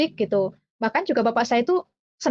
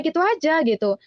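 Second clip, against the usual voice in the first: normal talking voice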